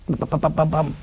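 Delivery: soft low bum bum like a heartbeat; heartbeat imitation. Describing a racing heart, soft heartbeat bums. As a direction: thump out a pulse softly. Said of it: "Your heart beats fast".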